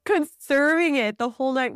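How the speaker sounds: normal talking voice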